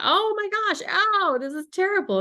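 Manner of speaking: high, loud cry of pain; high pitched ow, hurting; retold pained shout. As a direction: yelp it out like the biopsy hurts. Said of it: "I shouted".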